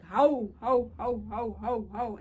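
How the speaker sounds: loud, repeated hooting "how"; imitated mocking laugh. Passerby laughing at her house, imitated mocking hoot. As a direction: fire off hoots, mimicking the passerby. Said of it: "he starts laughing".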